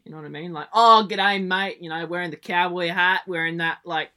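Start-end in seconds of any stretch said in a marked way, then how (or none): 0.7-1.8 higher, loud country Aussie greeting; real country accent. Acted. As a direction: ham up a country Australian hello. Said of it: "I just don't like sounding real country".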